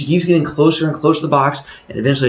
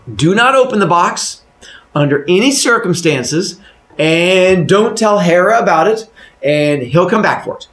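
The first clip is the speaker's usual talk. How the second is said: careful recitation; 7 semitones higher, 5 dB louder than usual. Acted. Husband repeating what Zeus told him, careful loud recital.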